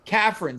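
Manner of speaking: "Catherine" with an F in place of TH, as CAFF-rin; pronounced with F. Shown as a demonstration. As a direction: say "Catherine" in two syllables, like CAFF-run. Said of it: "C A F R Y Y N".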